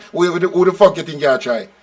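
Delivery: usual speaking voice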